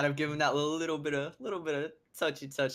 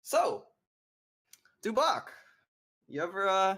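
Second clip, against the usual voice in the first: higher, teasing questioning; taunting interrogation; 9 semitones higher, 4 dB louder than usual. Acted. Adventurer showing the captive a dagger, high-pitched taunting. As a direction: toy with the prisoner, pitched up.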